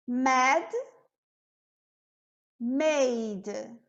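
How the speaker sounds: teacher reading word pairs slowly; minimal pairs read. Shown as a demonstration. Teacher reads pairs to students, clear and deliberate. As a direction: read each pair apart, slowly. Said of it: "E aqui eu trouxe um exemplo".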